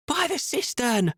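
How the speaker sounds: high, slow call, drawn out; ringing call. A narrator's reading. Esme on a rooftop calling to her partner, drawn-out call. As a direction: point them out to the whole square. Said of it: "Esme's voice rang out".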